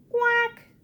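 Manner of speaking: high, loud quack; duck's quack. Acted. Mother duck quacking to her ducklings. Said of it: "says the duck".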